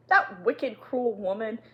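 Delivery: usual speaking voice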